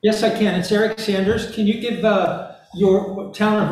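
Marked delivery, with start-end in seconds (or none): none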